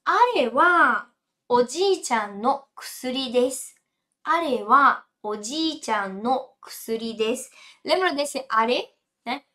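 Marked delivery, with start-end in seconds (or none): 0.0-3.7 slow model sentence. Shown as a demonstration. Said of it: "teacher models sentence slowly". 4.2-7.5 slow model sentence. Shown as a demonstration.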